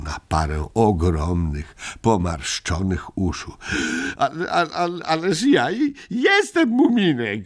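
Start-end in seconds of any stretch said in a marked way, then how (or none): 4.2-7.5 high, stammering despairing cry; a lightly voiced child in despair; desperate cry. A narrator's reading. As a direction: plead to be recognised. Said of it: "krzyknął z rozpaczą".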